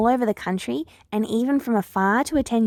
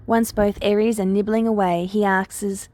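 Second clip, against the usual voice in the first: ordinary delivery, nothing marked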